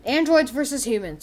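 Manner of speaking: ordinary talk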